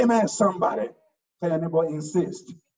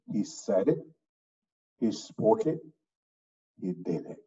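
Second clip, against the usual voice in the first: he said it, he spoke it, he did it, with a pause after each; deliberate pauses; 9 semitones lower, 5 dB quieter than usual. Shown as a demonstration. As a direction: space out each phrase for emphasis. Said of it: "I said again".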